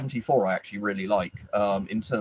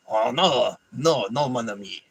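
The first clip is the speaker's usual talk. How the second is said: put-on French accent. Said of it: "Can I do a French accent?".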